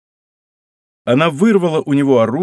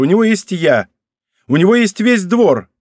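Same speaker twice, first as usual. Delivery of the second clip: stung pride, higher and forceful; wounded exclamation; 6 semitones higher, 4 dB louder than usual. A narrator's reading. Wounded princess asserting her place at court, indignant exclamation. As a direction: fire it back, hurt and indignant. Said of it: "воскликнула уязвлённая принцесса".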